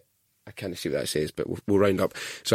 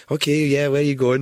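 louder, higher dispatcher voice; taxi operator; 6 semitones higher, 5 dB louder than usual. Retold or imitated. Taxi operator to a deaf old caller, raised voice. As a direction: speak up for a customer who can't hear. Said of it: "they'd be like".

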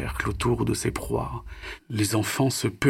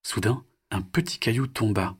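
usual speaking voice

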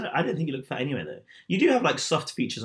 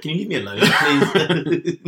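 high, loud whiny plea through laughter; mock his whining while cracking up; laughing mock plea; 5 semitones higher, 10 dB louder, slower than usual. Mocks his message while laughing.

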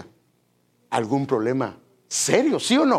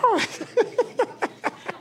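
laugh. Real, not acted. Laughs at own impression.

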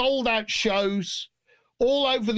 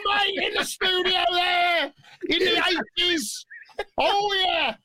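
excited shout; 7 semitones higher, slower than usual. Real, not acted. Telling the host about champagne, excited near-shout. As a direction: shout it out, delighted by the memory.

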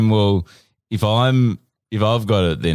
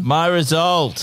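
exasperated; 10 semitones higher, slower than usual. Acted. Fed up after repeated errors.

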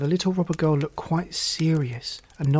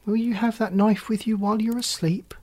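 put-on a frightened little girl, pitched up; frightened question; 6 semitones higher than usual. A narrator's reading. Gerda asks the robber girl, frightened and high. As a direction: ask it with a timid fright. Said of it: "looking at it in great fright".

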